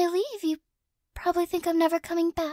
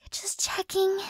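whisper. Real, not acted. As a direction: murmur it under your breath while checking. Quietly checking the pet's ears.

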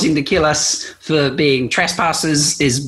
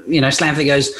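ordinary talk

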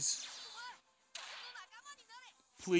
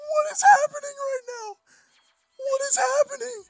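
much higher, louder, near-tearful squeal; real high, cracking excited squeal; squealing disbelief; 10 dB louder than usual. Real, not acted. Overwhelmed by the pulls.

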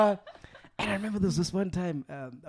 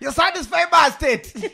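much higher, louder, gleeful boast; excited shout; 13 semitones higher, 9 dB louder than usual. Retold or imitated. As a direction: crow it at your dad. Chili repeats excitedly.

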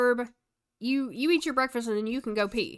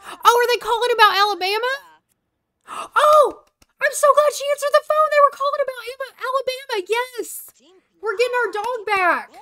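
much higher, louder, squealing with joy; overjoyed the lost dog is coming home; thrilled; 12 semitones higher, 7 dB louder than usual. Real, not acted. Pet dog returns unexpectedly.

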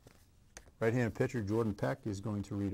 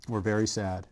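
ordinary delivery

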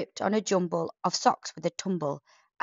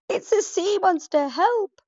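much higher, louder panicked cry for help; howling yelp; 14 semitones higher, 7 dB louder than usual. Acted. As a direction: yelp in terror. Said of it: "howled the chief with a yelp".

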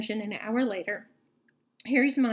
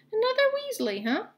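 much higher, amused; a playful surprised jab; Hagrid teasing; 12 semitones higher than usual. A narrator's reading. Hagrid voiced in read story.

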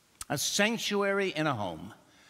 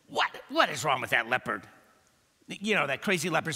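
quick, baffled chatter; fast puzzled monkey; faster than usual. Acted. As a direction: rattle it off like a nosy monkey. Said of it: "The monkey ... said".

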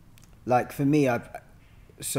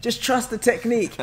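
mock calming teacher; 11 semitones higher, 7 dB louder, slower than usual. Teacher calming a panicking student.

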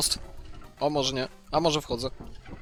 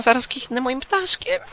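higher squeaky cartoon voice; squeaky silly voice; 9 semitones higher, 3 dB quieter than usual.

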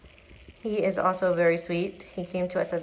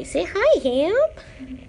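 higher, slower pet talk; 11 semitones higher, 4 dB louder, much slower than usual. Real, not acted. Introducing big shelter cat Ham to viewers, in high slow pet talk.

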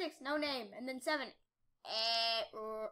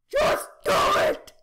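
slow, loud mock command; hammed-up shout; 11 semitones higher, 16 dB louder, slower than usual. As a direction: shout the dare at viewers. Daring viewers to comment.

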